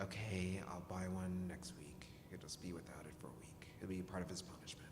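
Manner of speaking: low, trailing into a whisper; mock grudging concession. Mother retelling her concession to husband, muttered very quietly. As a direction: give in under your breath.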